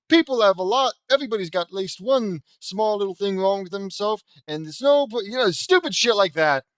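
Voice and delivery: high, loud and gushing; mock gushing hero. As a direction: ham up a sappy hero speech. Mocking an imagined JD speech.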